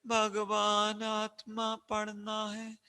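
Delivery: soft, very slow, droning recitation; mocking listless mumble. Teacher mimicking pupils' feeble answer, listless and slow. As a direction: imitate pupils answering with no energy. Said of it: "इतने ऐसे क्यों बोल रहे हैं".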